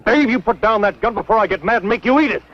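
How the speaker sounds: high, strained warning; tense threat. Acted. Cal warning Dave about the gun, strained threat. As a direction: warn him, voice tight with fear and anger.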